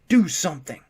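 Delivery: loud plea. A narrator's reading. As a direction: shout it at the guard. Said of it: "loudly and repeatedly".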